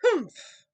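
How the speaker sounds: sharp high scornful humph; loud humph. A narrator's reading. Sour kangaroo scoffing at Horton, scornful humph. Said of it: "humphed a voice".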